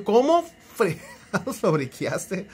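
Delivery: slow, drawn-out exasperated question; exasperated disbelief. Retold or imitated. [name] scolding a viewer who bricked his phone, slow and exasperated. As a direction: drag it out, you can't believe he bricked it.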